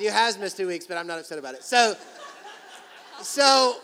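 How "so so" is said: laughing. Real, not acted.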